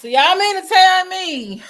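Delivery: disbelief. Real, not acted. Host reacting to guest's claims, loud disbelief. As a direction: stretch it out, can't believe it.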